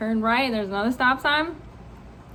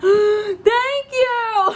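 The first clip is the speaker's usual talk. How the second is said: high, loud, relieved thanks; ecstatic relief; 9 semitones higher, 5 dB louder than usual. Retold or imitated. Student just told they passed, overjoyed relief. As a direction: squeal it out, half laughing.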